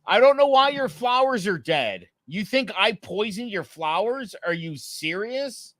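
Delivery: high-pitched, incredulous retold protest; indignant. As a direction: act out disbelief at being accused. Retold argument over dead flowers.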